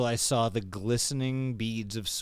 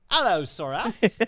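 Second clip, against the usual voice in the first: laughing; 6 semitones higher, 6 dB louder than usual. Acted. Laughing pronunciation play.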